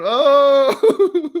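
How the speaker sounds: loud high laughing oh; imitating the player's whoop; mock celebration yell. Imitating the player celebrating on the ground, loud whoop. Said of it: "on the ground going".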